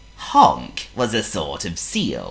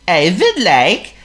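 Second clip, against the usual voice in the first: high, loud, slow seal voice; Honk the seal; 12 semitones higher, 9 dB louder than usual. Acted. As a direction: play a polite silly seal. Honk's character voice.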